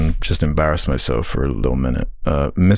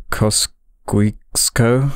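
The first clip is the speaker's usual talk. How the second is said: "Kosciuszko" read very slowly, piece by piece; sounding out; 5 semitones higher, much slower than usual. Real, not acted. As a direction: sound out "Kosciuszko" haltingly as an unknown name. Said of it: "that looks like".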